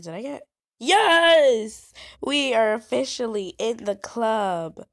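loud, high, thrilled cheer; excited. Real, not acted. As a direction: celebrate getting into the club. Finally joined the club.

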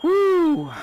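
startled gasp. Real, not acted. Jump scare on ride.

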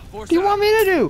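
exasperated yell. Real, not acted. Frustrated yell at teammate.

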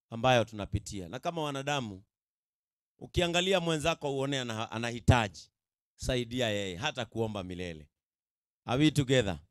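ordinary talk, nothing marked

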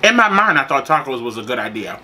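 muffled behind hand; talking with mouth full; hand over mouth, chewing. Real, not acted. Seen 2: hand covers mouth throughout, likely chewing taco; hand leaves at 1.8.

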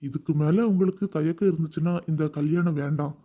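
deep man's voice, mock-sincere and earnest; low, pleading sincerity; heartfelt. A narrator's reading. Groom earnestly persuades the mother, deep and heartfelt. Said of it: "உருக்கமாக கூறினான்".

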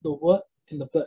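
W as "wuh", V as "vuh"; W and V as bare sounds, not letter names. Shown as a demonstration. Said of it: "almost the exact same sound".

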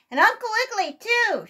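loud, high shouted call; shouting. A narrator's reading. Second voice shouts to Uncle Wiggily, shouting. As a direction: shout it out to someone off in a tent. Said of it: "shouted a second voice".

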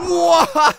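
high, sudden yell; playful battle cry. Pretend. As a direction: let out a launching roar. Battle noise while throwing.

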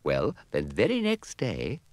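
ordinary delivery, nothing marked

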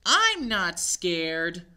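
put-on slow, loud child voice; brave little mummy. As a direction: insist bravely.

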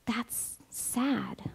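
breathy hushed whisper; preacher whispering a sad aside. Acted. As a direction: drop to a whisper as if sharing a secret.

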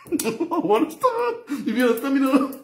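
high, laughing squeak. Real, not acted. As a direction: ask it through a fit of laughter.

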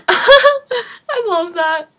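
cracking up mid-sentence; high, loud, drawn-out laughing speech. Real, not acted. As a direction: burst out laughing through the words. Cracking up at the video.

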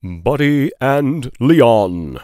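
slow announced chapter title; title read. A narrator's reading. Narrator announcing the chapter slowly.

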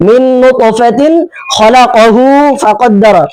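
normal talking voice

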